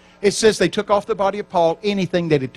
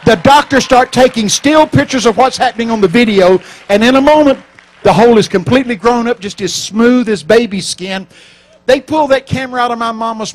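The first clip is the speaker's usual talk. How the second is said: loud, rising preacher's build; preaching at full force; 6 semitones higher, 10 dB louder than usual. Acted. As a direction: shout the miracle to the crowd.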